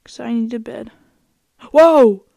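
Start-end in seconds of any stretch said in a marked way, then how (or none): 1.7-2.2 loud, high startled whoa; startled shout. Real, not acted. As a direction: jump at the surprise, loudly. Dog suddenly attacks sheep.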